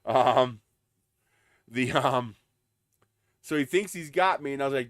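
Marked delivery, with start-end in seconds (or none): none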